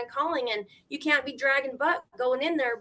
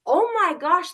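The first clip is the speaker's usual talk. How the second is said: loud, higher retold exclamation; amazed excitement; 5 semitones higher, 6 dB louder than usual. House hunter finding more rooms, amazed excitement. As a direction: burst out at a lucky find.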